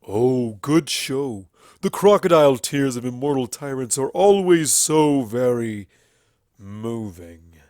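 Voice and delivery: slow, loud, sneering Everfree voice; droll venom. A narrator's reading. Everfree sneering at the princesses, slow and loud. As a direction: drip mocking venom over each word. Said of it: "His voice dripped with a droll venom".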